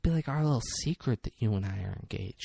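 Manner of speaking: soft, flirty murmur; flirtatious. Retold or imitated. Fiancée sharing the secret engagement with him, soft flirtatious voice. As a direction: coax softly, like sharing a secret. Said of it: "flirtatious and shit".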